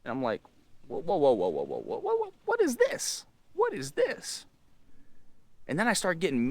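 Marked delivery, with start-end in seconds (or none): 0.9-4.5 rapid stammer leaping into a high squeak; hammed-up squeaky disbelief; mock alarm. Teen finding her with his ex on Facebook, mock alarm.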